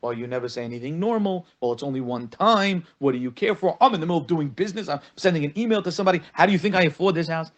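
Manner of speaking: fast, rising argumentative retort; defensive comeback. Acted. Husband snapping back at wife over his phone, defensive and rapid. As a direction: fire back excuses, building in pitch and speed. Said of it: "instead of him going back at her".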